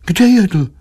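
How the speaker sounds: high inquisitive wife's question; suspicious high-voiced probing; prying. A narrator's reading. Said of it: "vyzvídala manželka".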